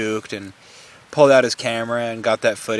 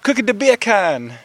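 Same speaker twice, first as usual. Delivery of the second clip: high-pitched mock Jamaican accent; hammed-up Jamaican lilt, pitched high; 9 semitones higher than usual. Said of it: "to get your Jamaican accent".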